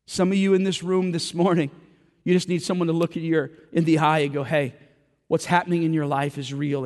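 full-force preaching. Acted. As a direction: preach it out to the room with fire.

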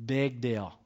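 low, understated brush-off; imitating an uncle's mild, unbothered dismissal; lovingly dismissive. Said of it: "he'd say it lovingly".

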